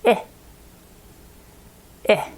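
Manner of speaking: put-on "Eh, eh" as the short i vowel, said twice for the child to echo; short i sound repeated. Shown as a demonstration.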